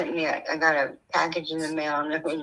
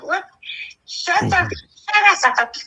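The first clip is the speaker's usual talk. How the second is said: laughing disbelief; 10 semitones higher, 5 dB louder than usual. Retold or imitated. Retorting to Rick about taxes.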